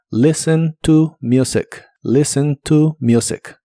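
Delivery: "Listen to music" slowly, silent t in listen, music starting with you; "listen to music" lento, sin t; slow pronunciation demo. Shown as a demonstration. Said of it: "La T de listen no se pronuncia".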